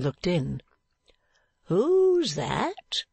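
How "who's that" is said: high, swooping challenge; startled cry. Acted. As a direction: call out in surprise, voice leaping up. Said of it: "cried a stout old man".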